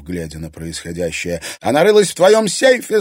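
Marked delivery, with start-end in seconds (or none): 1.6-3.0 high, loud wounded complaint; anguished cry. A narrator's reading. Anfisa accusing Irina to her husband, anguished cry. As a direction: cry it out like a suffering victim. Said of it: "страдальчески выкрикнула Анфиса".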